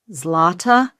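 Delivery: ordinary delivery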